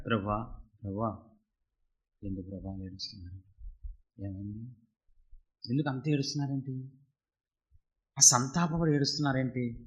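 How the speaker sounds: hushed, far-off angel's worried question; Gabriel asking softly. Acted. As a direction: lean in and ask the weeping Lord. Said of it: "అని అడిగితే".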